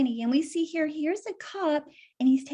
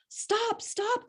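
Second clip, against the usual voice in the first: Harriet crying stop; 9 semitones higher, 4 dB quieter than usual. Acted. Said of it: "cried Harriet".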